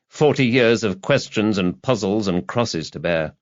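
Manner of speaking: plain reading voice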